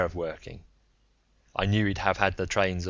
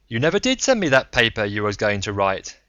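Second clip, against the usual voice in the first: put-on louder, blunt old man's voice; a rough self-made man ribbing him; Braun, gruff old gambler; 3 semitones higher, 7 dB louder than usual. Braun on the phone.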